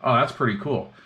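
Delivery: normal talking voice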